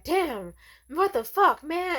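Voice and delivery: loud high angry yell. Retold or imitated. Cashier yelling after her down the street, loud angry yell. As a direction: shout after her as she runs off.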